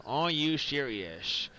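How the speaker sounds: slow, drawn-out mock disbelief; imitating the listener. Showing David the imitation of him, slow mock-incredulous voice. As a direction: stretch the question with a slurred "sh" on serious. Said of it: "That's me trying to imitate you".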